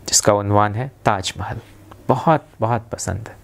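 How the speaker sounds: usual speaking voice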